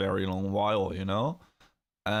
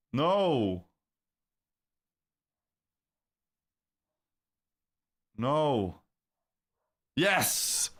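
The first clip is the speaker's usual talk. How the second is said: excited shouting; 6 semitones higher, much slower than usual. Real, not acted. Streamer yelling at the live price chart, real excited shouts. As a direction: cheer and groan at every tick.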